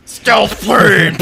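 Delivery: sudden high excited yell; mock shout. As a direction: blurt it out loud and high. Jokey shout about stealth bomber.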